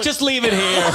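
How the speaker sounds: high, eager plea; a hint of a greedy passenger. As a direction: beg the food cart to stay. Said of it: "you're like".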